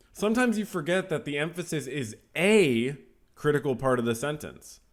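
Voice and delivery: "a" said as a long, stressed "ay" before "critical". Shown as a demonstration. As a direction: punch "a" as "ay", slight break before "critical". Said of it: "like if I said".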